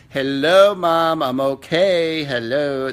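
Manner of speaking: loud, slow mock teen sass; sassy daughter. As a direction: drag it out like an eye-rolling teen. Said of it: "That's how she's talking".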